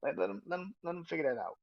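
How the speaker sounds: high mock shout telling Jimmy to let him fight; mock dismissive shout. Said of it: "He's just grabbing Jimmy like".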